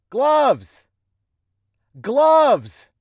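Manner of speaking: high yell to another room; calling out loudly. Acted. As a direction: holler for her across the house. Yelling for gloves.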